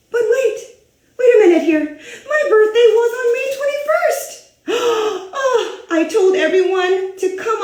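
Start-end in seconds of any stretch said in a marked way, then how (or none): 0.1-4.5 higher, louder, half-laughing dismay; sudden realization. Acted. Realizes birthday date mistake. 4.7-5.4 gasp. Acted. Shock at the wrong date.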